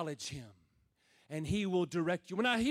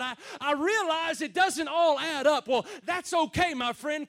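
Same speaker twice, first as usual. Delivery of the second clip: rising, louder preacher's cry; preaching at full force; 5 semitones higher, 5 dB louder than usual. Acted. Preacher urging the hurting congregation, full force. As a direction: build the fire of the sermon.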